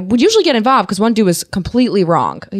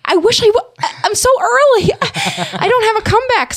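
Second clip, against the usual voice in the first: mock flustered; 14 semitones higher than usual. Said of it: "she was like".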